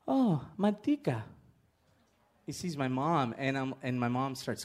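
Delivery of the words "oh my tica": tender recognition. Retold or imitated. Confused dad suddenly recognizing his wife, tender imitation.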